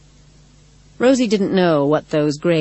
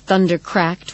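usual speaking voice

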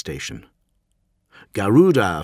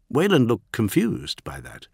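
ordinary delivery, nothing marked

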